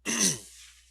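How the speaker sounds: loud sharp throat clear. Real, not acted. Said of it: "ooh, excuse me".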